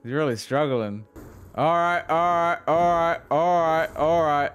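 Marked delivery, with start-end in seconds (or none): 1.5-4.5 louder flustered repeats. Real, not acted. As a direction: try to calm the chaos fast. Fending off an enemy.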